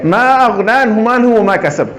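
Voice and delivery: slow chanted recitation; slow Quran recitation. Shown as a demonstration. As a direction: recite it slowly, stopping at the end. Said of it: "আপনি এক জায়গায় থামছেন". (ধীর তেলাওয়াত)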